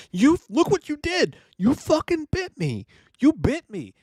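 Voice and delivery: high, slow, shocked female voice; upset woman. Retold or imitated. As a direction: play the bitten girlfriend, high and shocked. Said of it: "she's like".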